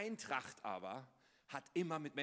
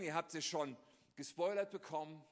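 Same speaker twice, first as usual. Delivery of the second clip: usual speaking voice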